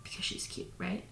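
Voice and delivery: whisper. Real, not acted. Fond aside about dog.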